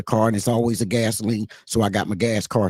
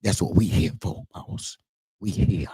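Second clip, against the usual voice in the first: low, soft Southern drawl; 6 semitones lower, 5 dB quieter than usual. Shown as a demonstration. Telling listeners why we're here, in a Southern voice. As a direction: lean into a Southern accent, pitched down. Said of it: "In my Southern voice".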